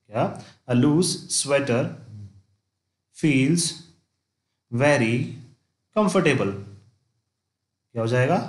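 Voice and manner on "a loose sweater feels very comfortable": slow dictation. Shown as a demonstration. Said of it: "dictating an example sentence slowly".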